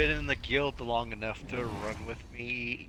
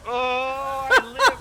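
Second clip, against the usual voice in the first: excited shout; 13 semitones higher, 12 dB louder, much slower than usual. Real, not acted. Narrowly survives the fight.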